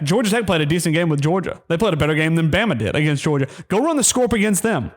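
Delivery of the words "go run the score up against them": angry challenge. Real, not acted. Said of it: "I've never been this angry".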